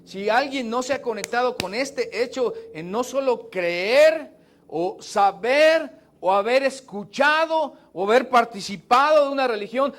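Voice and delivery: ordinary talk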